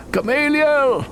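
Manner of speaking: loud, high scream of terror; desperate scream. Acted. Narrator hears the falls and screams for Gamaliel, loud high scream. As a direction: scream his name as the ice cracks. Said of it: "I screamed".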